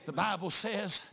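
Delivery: rising, fervent sermon delivery; preaching. Acted. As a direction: build the congregation up toward the climax. Preacher building the Elijah sermon.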